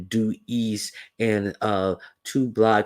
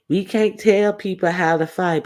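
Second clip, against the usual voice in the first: high, louder point; emphatic; 8 semitones higher, 4 dB louder than usual. Real, not acted.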